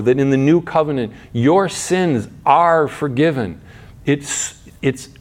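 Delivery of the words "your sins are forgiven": usual speaking voice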